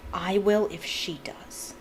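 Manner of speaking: slow words pushed through gritted teeth. A narrator's reading. Harry answering his uncle through gritted teeth, slow. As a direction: hold it back through clenched teeth. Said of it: "said Harry through gritted teeth".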